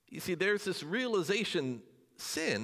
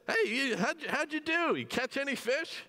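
higher, playful call from the shore as Jesus; mock Jesus call; 10 semitones higher than usual. Jesus calling to the fishermen on the boat, high and playful. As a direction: call out cheerfully across the water.

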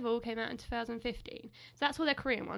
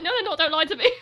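loud, higher mock protest with a laugh; indignant retort; 4 semitones higher, 11 dB louder, faster than usual. Telling her sister about denying it, mock indignant and loud. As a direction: playfully insist they're wrong. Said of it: "I was like".

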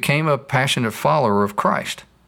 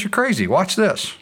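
cocky dog; 9 semitones higher than usual. Retold or imitated. Dog brags to the flower.

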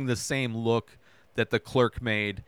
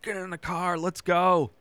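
high-pitched, held-back panicky urging; mock hysteria; 7 semitones higher than usual. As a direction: imitate someone frantically hurrying people along, pitched up but not shouted. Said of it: "Freedom comes out hysterical".

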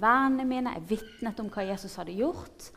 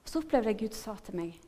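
usual speaking voice

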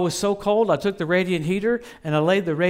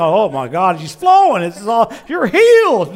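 mock excitement; 8 dB louder than usual. Parents imagined gushing over a showy healing, hammed up. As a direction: gush like thrilled parents.

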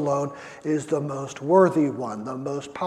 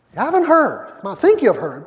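much higher and louder, punched out; emphatic rebuke; 8 semitones higher, 7 dB louder, faster than usual. Acted. Preacher rebuking the congregation, loud and high. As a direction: hit it hard as a rebuke.

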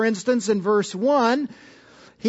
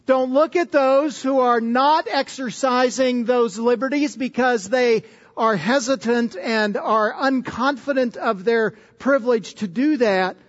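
ordinary delivery

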